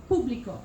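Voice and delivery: "pubblico" accented on "pub"; stress on third-to-last syllable. Shown as a demonstration. Teacher giving another example, first-syllable stress. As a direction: stress "PUB" in "pubblico", let "blico" slip. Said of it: "accent is placed usually on the third to last".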